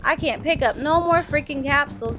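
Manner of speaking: ordinary delivery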